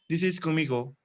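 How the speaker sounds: ordinary delivery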